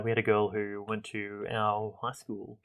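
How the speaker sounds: ordinary delivery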